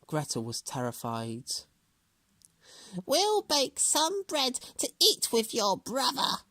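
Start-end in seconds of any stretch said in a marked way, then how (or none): 3.1-6.4 high, slow crafty hag; witch voice. A narrator's reading. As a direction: coax her like a witch. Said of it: "said the witch".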